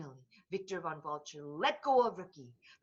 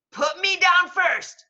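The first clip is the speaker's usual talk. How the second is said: high, loud, slow demand; villain bird dangling in a fist; villain plea; 11 semitones higher, 11 dB louder than usual. Acted. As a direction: squawk it at the robot. Victor begs robot.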